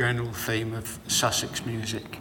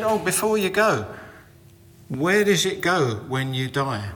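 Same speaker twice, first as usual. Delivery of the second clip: Jean's question; 5 semitones higher, 4 dB louder than usual. Retold or imitated.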